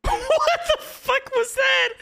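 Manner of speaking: slow, high disbelieving laugh. Real, not acted. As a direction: laugh through the words in disbelief. Reacting to a funny clip.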